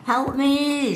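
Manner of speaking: high, drawn-out plea; cry for help. Acted. Annie racing down the track, cry for help. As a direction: wail it high, stretching the end.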